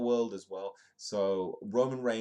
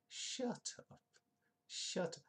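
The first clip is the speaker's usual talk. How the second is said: high breathy falsetto, mock disbelief; 11 semitones higher, 8 dB quieter, slower than usual. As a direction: squeal it like you can't believe it. Friend tells him shocking news.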